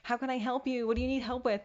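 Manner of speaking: chirpy offers of help; eager helpers. Retold or imitated. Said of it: "everyone's like".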